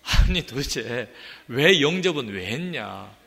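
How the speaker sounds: a hammed-up frustrated grumble; exasperated complaint. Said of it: "그러면".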